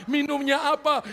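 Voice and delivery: normal talking voice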